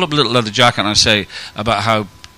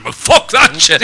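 put-on defiant teenage boy; 10 semitones higher, 7 dB louder, slower than usual. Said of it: "And he went".

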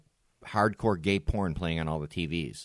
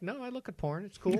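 offhand high reply; 14 semitones higher, 5 dB quieter than usual. Retold or imitated. Retold reply to bartender.

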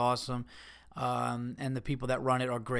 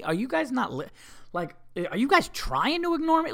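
exasperated parent; 10 semitones higher than usual. Retold or imitated. Exasperated parent scolding kids.

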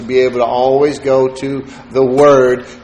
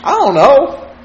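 much higher, shrugging "I don't know"; high mock answer; 10 semitones higher than usual. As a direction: play the shy lover, voice up high. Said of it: "It's so hard".

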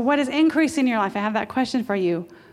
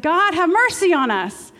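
louder, higher, slow plea; blind men crying out; 7 semitones higher, 5 dB louder, slower than usual. Acted. Blind men calling to God, loud slow plea. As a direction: call out for mercy from the roadside. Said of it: "They said".

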